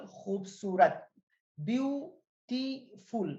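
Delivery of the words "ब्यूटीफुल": ब्यूटीफुल slowly broken into three syllables, byu-ti-ful. Shown as a demonstration. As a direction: say ब्यूटीफुल in three separate beats, pausing between syllables. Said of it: "तीन syllable हैं".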